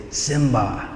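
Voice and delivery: soft, ghostly father's call, imitated; voice from the sky. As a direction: call softly, as from far away. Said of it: "He hears the voice say".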